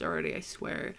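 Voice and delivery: a soft, sheepish whisper under the breath; whispered aside. Real, not acted. Podcaster admitting he sounds stupid, whispered sheepishly. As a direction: let it fall away into a whisper.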